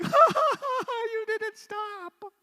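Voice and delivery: high, loud taunting giggle; child's mocking laugh. Child mocking the parent who didn't stop, laughing. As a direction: laugh like a kid who won.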